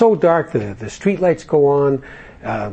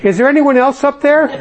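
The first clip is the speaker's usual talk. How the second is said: much higher and louder, laughing shout to the sky; desperate call upward; 12 semitones higher, 6 dB louder than usual. Acted. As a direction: yell up hoping someone else answers. Man calls out to sky.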